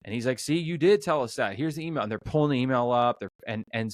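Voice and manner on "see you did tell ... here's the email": mock frustrated husband. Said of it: "really frustrated".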